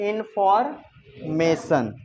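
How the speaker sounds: इन्फॉर्मेशन drawn out slowly, in-for... may-sun; pronunciation demo. Shown as a demonstration. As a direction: break इन्फॉर्मेशन in two, ending on "sun". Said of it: "ये बताओ ये क्या है?". (इन्फॉर्मेशन को तोड़कर धीरे बोलें, अंत में "सन")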